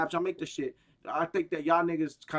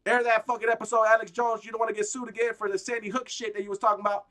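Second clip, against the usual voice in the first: ordinary talk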